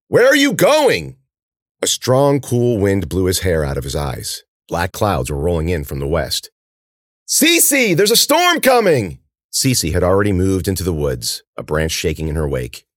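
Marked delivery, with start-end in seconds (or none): 0.1-1.2 loud, high shout after someone leaving; drawn-out, high-pitched call. A narrator's reading. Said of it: "Ben called". 7.3-9.2 high, loud, drawn-out warning yell; urgent long-distance shout. A narrator's reading. Shouting a storm warning after her.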